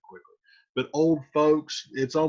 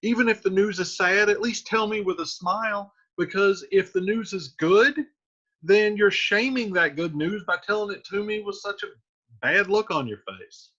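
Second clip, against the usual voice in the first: quick, higher modern Juliet; fast, higher, mildly exasperated girl; paraphrased Juliet; 8 semitones higher, faster than usual. Retold or imitated. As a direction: rattle it off like an impatient teen. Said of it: "So she's like".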